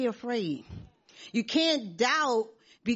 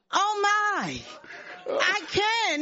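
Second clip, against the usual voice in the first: high, laughing amazement; amazed joy; 9 semitones higher, much slower than usual. Real, not acted. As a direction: laugh it out in wonder. Amazed she can see.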